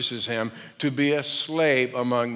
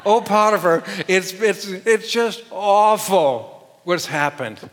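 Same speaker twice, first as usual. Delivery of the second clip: dramatic wife; 5 semitones higher, 6 dB louder than usual. Shown as a demonstration. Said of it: "repeat after me".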